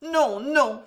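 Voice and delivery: high and brisk; quick. Acted. Marie quickly declining Jeanne's help, brisk. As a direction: refuse quickly but kindly. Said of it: "fit vivement Marie".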